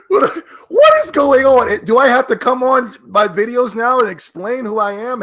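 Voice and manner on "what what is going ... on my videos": cracking up in exasperation; laughing disbelief. Real, not acted. Vlogger reacting to the Microsoft video, laughing in disbelief.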